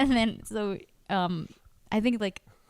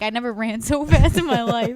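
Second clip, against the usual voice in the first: laughing while quoting; 4 semitones higher, 6 dB louder than usual. Retold or imitated. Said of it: "she's like".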